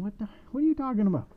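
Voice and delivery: loud, high, rapid exasperation; exasperated shout from a baffled witch. Acted. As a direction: call it out high and fast. Witch with head in the oven.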